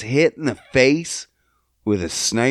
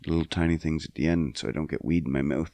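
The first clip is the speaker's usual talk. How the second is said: normal talking voice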